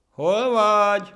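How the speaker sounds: very high, drawn-out loud call. Acted. Monkey calling the ant from the other tree, shouting. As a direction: holler across to the straggler. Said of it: "kiabált a másik fától".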